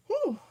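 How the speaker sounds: loud sighing yawn. Real, not acted. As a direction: let out a big yawn. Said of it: "I'm sorry, guys".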